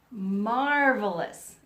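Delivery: slow, loud, savoured Marvelous; drawn-out marvelous. Shown as a demonstration. Teacher modelling Marvelous for the class, slow and loud. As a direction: stretch out Marvelous slowly and proudly.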